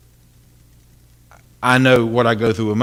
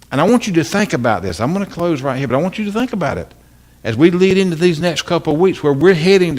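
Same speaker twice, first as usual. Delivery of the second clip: preaching at full force; 5 semitones higher than usual. Acted. Preacher closing the sermon, building to full force. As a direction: drive the closing home with fervor.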